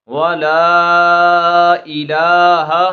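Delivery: "वला इला" drawn out very slowly, the vowels lengthened; stretched madd. Shown as a demonstration. Teacher reciting the elongated madd for students, slow and stretched. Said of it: "इसको इंशाल्लाह हम खींच कर पढ़ेंगे".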